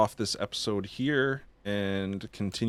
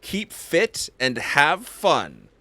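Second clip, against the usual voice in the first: sign-off catchphrase; 4 semitones higher, 7 dB louder, slower than usual. Acted.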